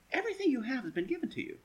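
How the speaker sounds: Paul scolding the church, snide and quick. Retold or imitated. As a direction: needle them with a mocking edge.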